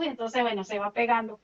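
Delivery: usual speaking voice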